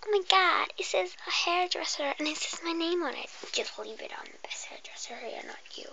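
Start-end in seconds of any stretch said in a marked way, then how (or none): 3.5-5.9 barely audible, much softer whisper; faint whisper. Acted. Customer mutters doubts about the hairdresser. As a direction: drop to a hushed breath.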